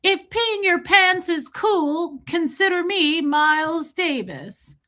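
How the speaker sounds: put-on high, drawn-out old-lady movie voice; stretched-out, high mock old woman; old lady impression. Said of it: "when the really old lady is like".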